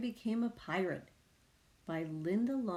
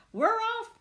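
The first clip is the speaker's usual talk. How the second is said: high, loud cheer; crew shout; 6 dB louder than usual. A narrator's reading. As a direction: shout it all together. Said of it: "they all shouted".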